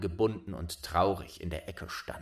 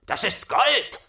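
much higher, loud greedy shout; robbers shouting; 13 semitones higher, 8 dB louder than usual. A narrator's reading. Robbers rushing the carriage, high loud shout. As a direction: yell it out as the robbers pounce. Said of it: "riefen sie".

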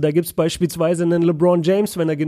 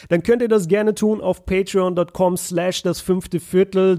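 ordinary delivery

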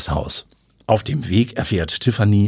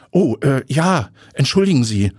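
higher, flustered hesitant apology; startled and halting, pitched up; startled stammer; 6 semitones higher, 3 dB louder than usual. A narrator's reading. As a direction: stammer an apology, caught off guard. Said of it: "stottert Tiffany und fährt erschrocken herum".

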